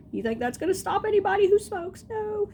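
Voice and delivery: high-pitched rhetorical scoffing; mock-defiant retort.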